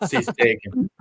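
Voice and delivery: quoting Forrest Gump in passing. Pretend. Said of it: "Forrest Gump 'em".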